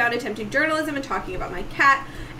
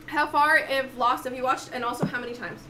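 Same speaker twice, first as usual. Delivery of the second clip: ordinary talk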